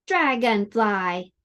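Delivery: slow Dragonfly, three clear beats; clapped syllables. Shown as a demonstration. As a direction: clap out Dragonfly slowly. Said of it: "How many claps?".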